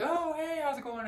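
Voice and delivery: put-on high, bright and breezy hello from an old flame; casual ex greeting. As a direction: toss it off like a long-lost ex popping up. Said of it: "somebody out of the blue is gonna be like".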